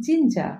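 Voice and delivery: "জিঞ্জা" with silent final r; correct pronunciation. Shown as a demonstration. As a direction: end জিঞ্জা on an open a. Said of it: "correct pronunciation হবে". ("জিঞ্জা" শেষে র ছাড়া শুদ্ধ উচ্চারণ)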